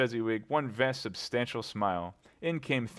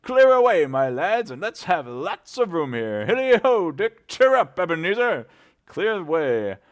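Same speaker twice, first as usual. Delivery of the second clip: booming, much louder and higher jolly call; fat jovial voice; 9 semitones higher, 11 dB louder than usual. A narrator's reading. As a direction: bellow cheerfully across the warehouse. Fezziwig rousing the lads.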